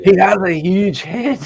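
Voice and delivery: high, slow, put-on Scottish accent; hammed-up Scottish exclamation. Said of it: "my Scottish accent".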